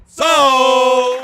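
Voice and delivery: loud auctioneer shout; shouted sold. Acted. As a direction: bang it out like a gavel. Auctioneer closes the sale.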